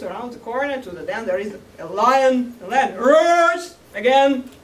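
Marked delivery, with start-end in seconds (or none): 3.0-4.5 storyteller mimicking a lion's roar; roaring emphasis. A narrator's reading. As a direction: let the word itself roar out.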